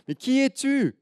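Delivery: put-on high, loud child's question; loud high who are you; little girl calling. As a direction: shout it into the mountains like a curious girl. Girl shouting to the echo.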